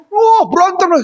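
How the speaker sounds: put-on high, petulant kid's reply; sulky child. Daughter to her father after a fight, high and petulant.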